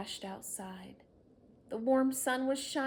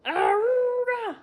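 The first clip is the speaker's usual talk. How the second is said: slow, high, loud horn call; loud honk call; 14 dB louder than usual. Acted. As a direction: blast it like a bus horn. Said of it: "grabbed Harry's horn".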